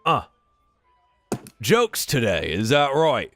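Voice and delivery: measured, pointed barkeep delivery; tavern keeper. Acted. Barkeep to a joking customer at his bar, measured and pointed. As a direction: spell it out slowly to a tiresome customer. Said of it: "places a glass down and goes".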